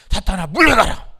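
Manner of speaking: loud preacher's shout; commanding rebuke. Shown as a demonstration. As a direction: shout it for the congregation to copy. Preacher models rebuke for congregation. (사탄을 쫓는 큰 외침)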